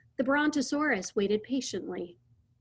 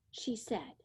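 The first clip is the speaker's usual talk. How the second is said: ordinary delivery, nothing marked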